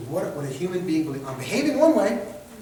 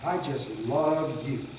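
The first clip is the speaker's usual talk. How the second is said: slow, lower, insincere declaration; passive-aggressive; 4 semitones lower, 4 dB quieter, much slower than usual. Shown as a demonstration. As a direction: drag the words out so they mean the opposite. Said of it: "You know, I hate you".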